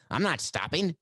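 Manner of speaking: high-pitched defiant declaration; insist, voice jumping high; emphatic insistence. Acted. Player arguing past security to reach the field, emphatic. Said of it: "Was that emphatic?".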